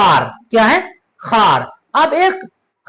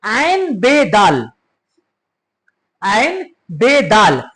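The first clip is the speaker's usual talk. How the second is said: ऐन बे दाल spelled letter by letter, very slowly; letters ऐन बे दाल spelled slowly for note-taking; slow spelling out; much slower than usual. Shown as a demonstration. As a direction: dictate ऐन बे दाल slowly for students. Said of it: "उसके बाद है अबद".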